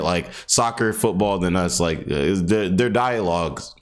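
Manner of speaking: normal talking voice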